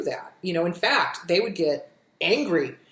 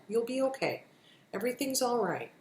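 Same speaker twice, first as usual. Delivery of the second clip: softer, gentle reassurance; self-soothing talk; 3 semitones higher, 7 dB quieter than usual. Shown as a demonstration. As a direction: hush yourself kindly. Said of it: "practice when you're feeling ... unsettled".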